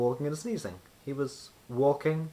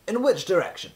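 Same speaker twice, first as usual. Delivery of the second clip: wizard voice; 8 semitones higher, 6 dB louder than usual. A narrator's reading. Wizard asks.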